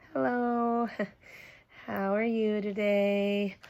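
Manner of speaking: soft, slow nervous innocence. Acted. Junie B nervously greeting angry Daddy. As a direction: play it off sheepishly. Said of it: "I waved my fingers very nervous".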